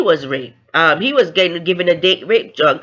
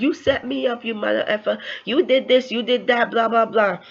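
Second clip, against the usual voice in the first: flipping out; 6 semitones higher than usual. Retold or imitated. Woman accusing the man who set her up, retold flipping out. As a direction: act out her outrage with raised pitch. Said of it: "And she was flipping out".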